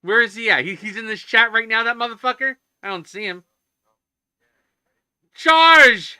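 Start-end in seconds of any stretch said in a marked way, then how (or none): 5.3-6.2 big high shout of a friend's name; loud greeting call. Real, not acted. Streamer hails his friend joining, a big high shout. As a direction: call out to him across the room.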